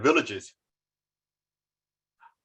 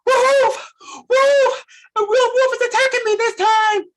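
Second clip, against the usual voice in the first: high, loud panicked shout; desperate yell; 8 dB louder than usual. Acted. Shepherd boy yelling for help as a real wolf attacks, high and loud. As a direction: scream for real help now.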